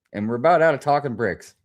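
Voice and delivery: ordinary talk, nothing marked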